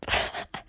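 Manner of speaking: soft choked laugh-cry; a quiet laugh catching on tears; real teary little laugh. Real, not acted. Overwhelmed, tearing up.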